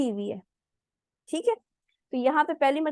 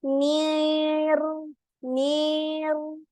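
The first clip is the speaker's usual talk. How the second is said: very slow, higher नीर with a dragged gap before the r; wrong drawn-out way; 5 semitones higher, much slower than usual. Shown as a demonstration. Teacher mimics students who take too long from noon to ra, slow drawn-out. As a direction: stretch नीर lazily from n to r, the mistake. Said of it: "बहुत time लगाती हैं".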